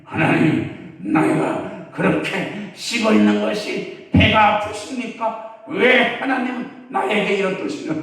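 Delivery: loud, high-pitched mock protest; indignant complaint. Jonah complaining to God about the plant, hammed-up indignant outcry. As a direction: wail an aggrieved complaint at full volume.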